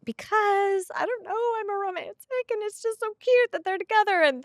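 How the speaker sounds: high-pitched, tearful and moved; tearing up. Real, not acted. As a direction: squeak through welling tears. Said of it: "literally tearing up a little bit".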